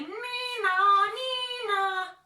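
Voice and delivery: sung police siren; siren sound. Acted. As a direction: sing it like a siren. Siren imitation.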